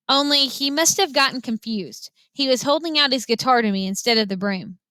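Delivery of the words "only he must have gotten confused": ordinary delivery, nothing marked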